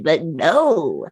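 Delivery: usual speaking voice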